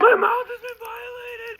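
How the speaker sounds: high, loud, slow whimper; anguished wail. Real, not acted. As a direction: wail in sour agony. World's most sour candy.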